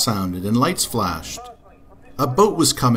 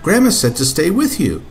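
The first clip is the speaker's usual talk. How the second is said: put-on much higher child voice; little girl voice; 6 semitones higher, 4 dB louder than usual. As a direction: voice a small girl. Katy voiced.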